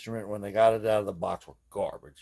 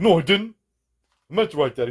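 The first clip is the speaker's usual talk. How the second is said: higher mock Brooklyn accent, insisting; 7 semitones higher, 7 dB louder than usual. Recalling a childhood friend, in a mock Brooklyn accent. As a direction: imitate a stubborn kid in a Brooklyn accent. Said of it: "He's saying it in, like, a Brooklyn accent".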